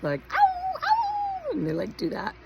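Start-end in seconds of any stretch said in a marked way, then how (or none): 0.3-1.5 very high cartoon howl. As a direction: squeal it like a cartoon wolf. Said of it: "with the cartoon characters".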